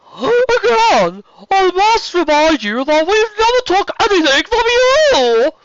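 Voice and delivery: loud, very high imperious voice; serpent queen. Acted. Queen rebutting the dragon's claim, loud and imperious. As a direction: declare it grandly, high and loud. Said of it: "a new voice spoke".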